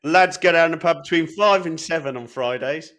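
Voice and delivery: put-on high, loud jokey voice for the toddler; mock lad voice. Voicing the toddler heading to the pub, high and loud.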